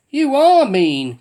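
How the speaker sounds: loud, slow sulky protest; cried. A narrator's reading. Tubby Bear refused a ride, crying out sulkily. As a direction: drag it out like a hurt child. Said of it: "cried Master Tubby".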